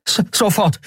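high, stuttered quick answer; stammering eager boy. A narrator's reading. Miko rushes to obey Keru, stammering high. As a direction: blurt it out, tripping on the first sound. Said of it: "rannte hastig".

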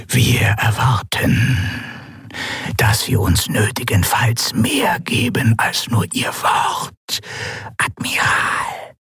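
slow, eerie, voiceless whisper; alien whisper. A narrator's reading. The masked governor warns the admiral in a ghostly whisper. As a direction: let the strange voice hiss out each word slowly.

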